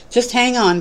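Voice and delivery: pitched high, pleading cry. A narrator's reading. Dangling man cries up to the man on the bridge, high pleading cry. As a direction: call up the rope to keep him holding. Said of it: "cried the dangling man".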